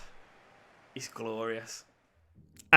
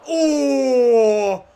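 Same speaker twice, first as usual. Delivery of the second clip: sharp high groan at the miss; anguished oh; 10 semitones higher, 8 dB louder than usual. Real, not acted. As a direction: gasp as the shot goes wide. Near miss chance.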